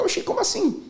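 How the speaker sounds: imitated high-pitched bafflement; mock startled question; surprised reaction. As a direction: jump up high in disbelief. Client reacts to surprise ending.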